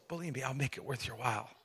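Jesus quietly reassuring Peter; smiling reassurance. Retold or imitated. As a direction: smile through it, calm and kind. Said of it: "Jesus just kind of smiles and says".

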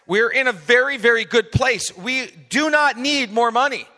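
we are fine, nobody needs to give; emphatic insistence. Real, not acted. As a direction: insist hard that money is not needed. Pastor insisting no money needed.

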